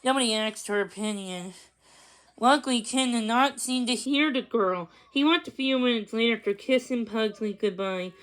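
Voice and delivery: plain reading voice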